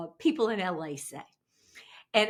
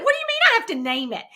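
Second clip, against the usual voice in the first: high, fast, incredulous squeal; bewildered newbie learning YouTube rules; baffled disbelief; 13 semitones higher, 7 dB louder, much faster than usual. Retold or imitated. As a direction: toss it out totally thrown by the question. Retells her own baffled reply.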